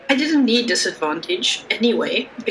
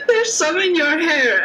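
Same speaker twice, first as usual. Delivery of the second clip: put-on giggly drunk; 8 semitones higher than usual. Giggly drunk whispering to friend.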